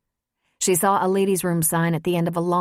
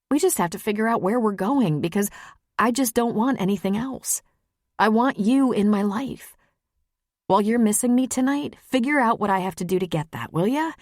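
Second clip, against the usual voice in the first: higher-pitched woman declaring what she wants; earnest, insistent Maggie; 5 semitones higher than usual. Acted. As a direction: press your lover earnestly, pitched up.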